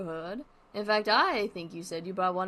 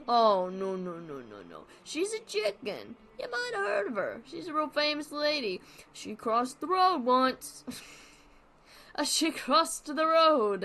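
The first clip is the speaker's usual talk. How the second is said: put-on higher comedian dog with a Brooklyn accent; Brooklyn Barnaby; 6 semitones higher, 4 dB louder than usual. Barnaby setting up a chicken joke, Brooklyn accent. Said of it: "He's turning Brooklyn".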